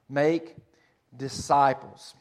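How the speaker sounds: Make, a pause, then disciples, slow and deliberate; emphatic pause. Shown as a demonstration. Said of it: "the point of emphasis is on make disciples".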